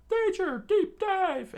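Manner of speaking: very high, loud yelled warning; high cartoon crew alarm call; crew shouting warning. Crew warns to dive.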